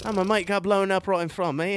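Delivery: a joking Scottish soldier's voice; Scottish accent. Retold or imitated. Host mimicking a British soldier's cheerful understatement, Scottish accent. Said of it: "we need to work on your Scottish accent".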